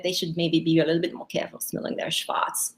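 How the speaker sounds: ordinary talk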